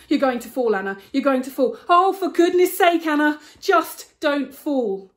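loud, tense warning to herself; frightened self-talk. Acted. Frozen on the ridge, self-talk.

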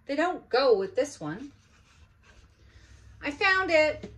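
put-on pleased kid announcing a find; excited child. Olivia telling mother she found it, loudly excited. Olivia answering, finding sock.

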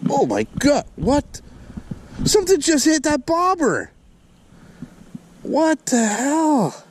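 high, startled disbelief; real astonishment. Real, not acted. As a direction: react high-pitched to a sudden strike. Something suddenly hits the bobber.

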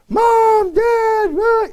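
high, loud imitation of squabbling kids; a father imitating his children tattling, high and whiny. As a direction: mimic the kids calling for mom and dad, high and loud. Kids squabbling voiced by parent.

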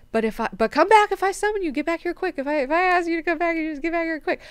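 fast, high-pitched urgent summons; acted flustered command, quick and high; hurried mock order. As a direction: rattle it out in a rush.

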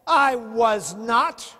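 loud, very slow I was not; vehement denial. Retold or imitated. Peter denying Jesus to the bystanders, loud slow denial. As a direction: deny it forcefully.

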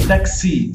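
Taxi with the final i loud and high; strong final i. Shown as a demonstration. Teacher stressing the final i of Taxi for students. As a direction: hit the i in Taxi hard. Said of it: "Tem que falar esse I bem forte".

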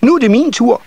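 put-on very high, eager child voice; second-eldest nisse child. A narrator's reading. Second child claiming a turn, high and eager. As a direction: pipe up loud and high as a little child. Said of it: "sagde den næstældste".

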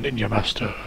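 soft flat caption voice; deadpan title read. Pretend. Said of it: "it just flashes on the screen".

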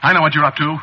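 high, agitated accusation; agitated and alarmed; alarmed threat. Acted. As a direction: threaten them as panic rises. Panicking, threatens to fetch police.

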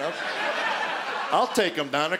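normal talking voice

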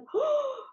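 high startled gasp of realization; a sudden intake of breath in shock; sharp gasp. Retold or imitated. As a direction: she realizes her childhood vow. Realizing the vow.

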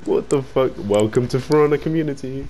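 ordinary talk, nothing marked

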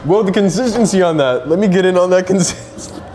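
mocking a gushing restaurant diner.

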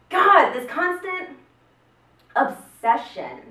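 high, loud, slow exasperation; exasperated comic rant; disgusted rant. Acted. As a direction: drag it out in disgust. Ranting about caller ID.

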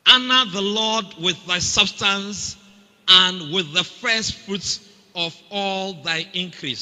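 slow, loud, solemn Bible recitation; scripture reading. Real, not acted. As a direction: proclaim the verse slowly and grandly. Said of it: "reads scripture aloud formally".

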